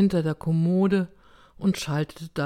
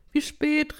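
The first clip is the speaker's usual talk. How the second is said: high, insistent call; high-pitched old woman's demand; 10 semitones higher than usual. A narrator's reading. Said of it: "rief sie".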